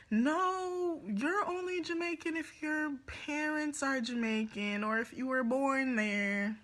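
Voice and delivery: high, emphatic no; indignant correction. Real, not acted. Correcting people who claim Jamaica, high and indignant. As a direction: set them straight, exasperated.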